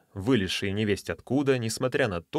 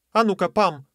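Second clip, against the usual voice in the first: calling out; 9 semitones higher, 6 dB louder than usual. Acted. Lady calls to Pam.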